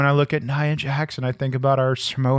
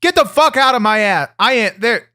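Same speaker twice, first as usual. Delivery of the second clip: higher, louder angry outburst; angry shout; 7 semitones higher, 5 dB louder than usual. Real, not acted. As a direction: bark it at him, fed up. Irritated rebuke, repeated louder.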